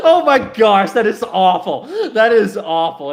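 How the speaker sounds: cracking up loudly; laughing disbelief. Real, not acted. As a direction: burst out laughing at your own awful attempt. Cracks up at own smolder attempt.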